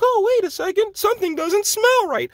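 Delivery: late-realizing crowd mocked in a high voice; mock clueless voice. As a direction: parrot them in a high silly voice. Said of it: "everybody's like".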